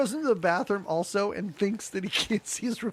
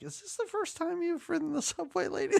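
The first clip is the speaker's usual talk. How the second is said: softer, much higher, teasing; mocking question; 8 semitones higher, 7 dB quieter than usual. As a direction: tease her like a clueless tourist. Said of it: "I'm like".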